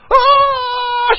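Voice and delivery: high, loud shriek of "Oh"; joyful scream. Acted. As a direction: shriek with sudden joy like the stunned wife. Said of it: "She starts screaming".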